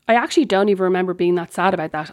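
ordinary talk, nothing marked

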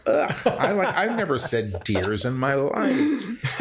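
amused disbelief breaking into laughter; laughing exasperation. Real, not acted. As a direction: groan and laugh at the absurdity. Laughing exasperation at guide's word.

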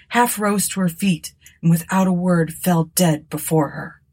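plain reading voice

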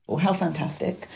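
deadpan, mocking delight; sarcastic. Real, not acted. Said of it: "I'm saying that very sarcastically".

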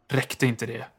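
ordinary delivery

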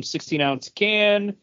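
usual speaking voice

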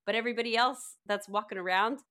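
normal talking voice

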